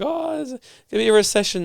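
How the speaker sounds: high mocking voice of worried people; mock panic. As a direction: parody the panicky crowd. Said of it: "people are like".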